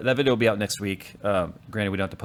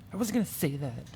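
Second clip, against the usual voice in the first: put-on teenage retort; 5 semitones higher, 5 dB quieter than usual. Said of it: "total teenager response".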